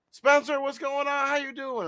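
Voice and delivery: high, loud friendly greeting; eager stranger. Retold or imitated. College stranger in the store greets Spencer, high and eager. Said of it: "he was like".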